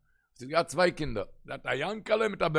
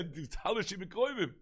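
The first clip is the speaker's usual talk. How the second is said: ordinary talk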